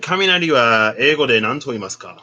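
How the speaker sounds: asking in careful Japanese; Japanese question. Retold or imitated. Asking Siri in Japanese, careful Japanese question. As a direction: say it as a Japanese learner would. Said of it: "I was gonna be like".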